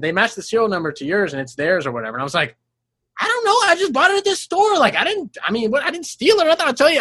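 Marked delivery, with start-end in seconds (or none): 3.2-7.0 high, anxious protesting innocence; pleading, voice pitched up; nervous defensive. Retold or imitated. Retold defending self to detectives.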